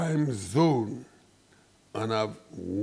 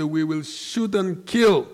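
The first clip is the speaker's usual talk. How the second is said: mock commissioner's vow, higher, louder and slow; firm declaration; 7 semitones higher, 5 dB louder, slower than usual. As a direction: declare it like a police chief taking a hard stand. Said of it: "should never be afraid to say".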